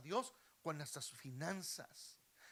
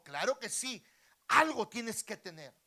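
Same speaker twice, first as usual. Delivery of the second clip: raised, forceful royal voice; loud insistence; 6 semitones higher, 14 dB louder than usual. Acted. King pressing the beggar to find something, loudly insisting.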